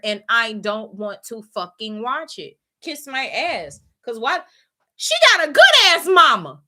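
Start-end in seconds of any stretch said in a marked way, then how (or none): 5.0-6.6 loud, high burst of outrage; emphatic outburst. Real, not acted. Ranting to viewers about the show, loud indignant outburst.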